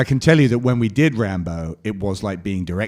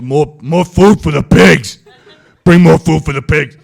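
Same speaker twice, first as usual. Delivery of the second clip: barked order; 7 semitones higher, 13 dB louder than usual. Retold or imitated. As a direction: bellow at the crew to bring food. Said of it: "he said".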